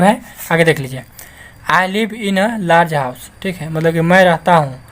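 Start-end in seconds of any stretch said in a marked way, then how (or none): 1.7-3.2 very slow, word by word; slow dictation; slow reading. Shown as a demonstration. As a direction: read the example for learners. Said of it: "teacher reads example sentence".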